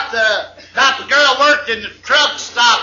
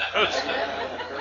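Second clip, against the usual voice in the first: soft breathy laugh; 11 dB quieter than usual. Real, not acted. Teller laughs at own joke.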